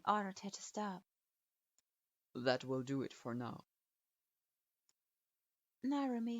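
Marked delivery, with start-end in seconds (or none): 2.4-3.7 put-on low, curt old teacher grading a student; a grudging low verdict; stern sensei voice. A narrator's reading. Strict sensei grudgingly approving his student, low and curt.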